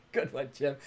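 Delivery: laughing through the words. Real, not acted. Host laughing at a viewer's shirt comment, amused. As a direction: laugh it out at the joke.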